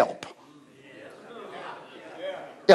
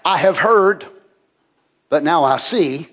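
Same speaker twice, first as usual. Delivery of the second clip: ordinary delivery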